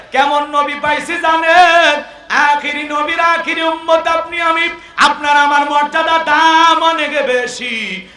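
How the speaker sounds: slow, high and loud rising chant; preacher lifts the crowd with praise, chanting loud; impassioned preaching. Real, not acted. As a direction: preach it out with full fervour, slowly. Sermon climax.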